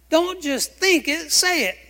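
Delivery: emphatic inner pep talk; urging himself. Retold or imitated. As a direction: tell yourself firmly to speak it out. Preacher retelling his own self-talk.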